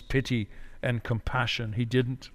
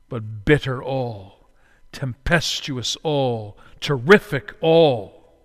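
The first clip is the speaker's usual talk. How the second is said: ordinary talk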